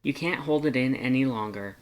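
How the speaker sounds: plain reading voice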